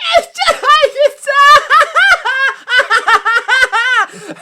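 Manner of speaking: loud, high shrieking cackle; unhinged mom's screaming laugh; crazed laugh. Retold or imitated. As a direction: cackle like she's lost her mind. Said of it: "she just lost her mind".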